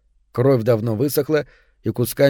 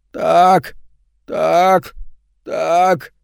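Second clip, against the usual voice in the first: higher otherworldly grating repeats; eldritch horror; 5 semitones higher, much slower than usual. A narrator's reading. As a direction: grate out each word like a ghoul. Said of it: "скрежещет он потусторонним голосом".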